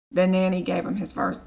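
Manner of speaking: ordinary talk, nothing marked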